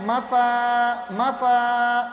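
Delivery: مفا with a long, held final aa; short first sound, then stretch the end of مفا; drawn-out vowel. Shown as a demonstration. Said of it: "کھینچ کر پڑھتے ہیں". (مفا کو کھینچ کر)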